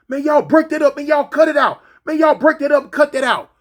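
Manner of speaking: high, loud mock officer order; imitating a guard on the intercom; officer on speaker. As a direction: call it over a jail speaker. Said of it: "come over the speaker thing".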